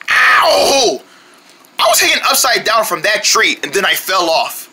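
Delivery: ordinary talk, nothing marked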